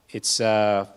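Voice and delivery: normal talking voice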